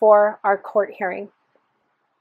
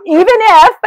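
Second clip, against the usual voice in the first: higher and louder, bursting with laughter; loud laughing exclamation; 9 semitones higher, 12 dB louder than usual. Acted. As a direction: blurt it out laughing at yourself. Mantra blurted with laugh.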